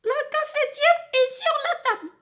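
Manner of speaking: way up high, almost a squeak; high falsetto demo line; high-pitched voice. Shown as a demonstration. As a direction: jump to a very high thin voice for the demo. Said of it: "je peux utiliser aussi la voix aiguë".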